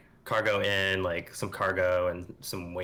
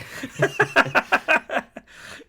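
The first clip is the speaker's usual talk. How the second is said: loud breathy high laughter; high unvoiced giggle; 7 dB louder than usual. Real, not acted. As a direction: burst out giggling. After goodbye to robot.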